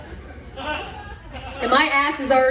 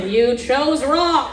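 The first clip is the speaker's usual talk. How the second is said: slow ominous judgment; 3 semitones higher, 3 dB louder, much slower than usual. Pretend. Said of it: "The shame staring you in the face".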